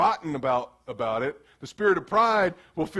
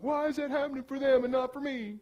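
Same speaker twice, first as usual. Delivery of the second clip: higher, soft, sorry-for-yourself whine; mock self-pity after hanging up; 5 semitones higher, 5 dB quieter than usual. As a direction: whimper it like a sulking child. Crying alone in your room.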